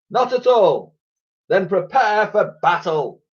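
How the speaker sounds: hammed-up shipboard exchange; comic sailors' back-and-forth. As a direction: call across ships in mock politeness. Ships' exchange continued.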